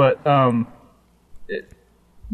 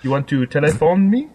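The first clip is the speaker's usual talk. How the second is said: hammed-up, badly faked French accent; 3 semitones higher, 3 dB louder than usual. Host mocking a French carrier buying T-Mobile, bad French accent. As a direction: joke French with a wobbly accent. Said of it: "I can't do a good French accent".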